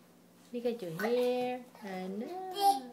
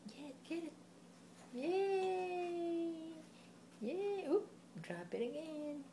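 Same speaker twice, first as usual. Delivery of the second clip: ordinary talk, nothing marked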